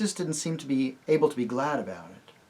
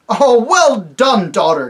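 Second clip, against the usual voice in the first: high, loud slow praise; a king praising the archer girl; king's praise; 10 semitones higher, 13 dB louder, slower than usual. Acted. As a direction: cheer her on across the field. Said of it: "came Tyrion's voice".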